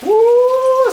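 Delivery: loud happy whoop; excited whoop. Real, not acted. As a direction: belt out a joyful woo. Excited whoop over sizzling pan.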